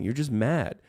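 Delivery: normal talking voice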